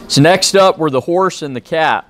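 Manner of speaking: ordinary delivery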